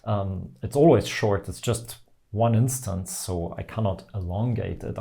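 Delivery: usual speaking voice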